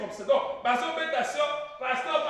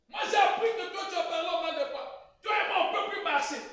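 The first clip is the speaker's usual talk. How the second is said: raised pitch, a touch of an offended caller on the phone; angry caller; 7 semitones higher than usual. Acted. Caller confronting Pasteur Basengo on the phone, offended. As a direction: accuse him down the line, voice pitched up. Acted phone call to a pastor.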